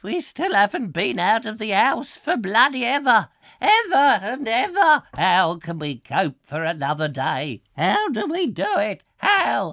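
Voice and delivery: high, melodramatic lament; mock despair. Presenter lamenting endless lockdown, mock despair. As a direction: cry out in hammed-up anguish.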